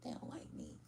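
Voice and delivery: low, quiet self-doubting whisper; whispered insecure aside. Retold or imitated. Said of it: "somebody will be like".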